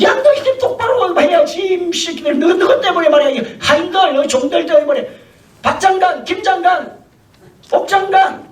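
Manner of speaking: hammed-up royal scolding; a king half-laughing while he scolds. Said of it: "막 야단을 치는 겁니다".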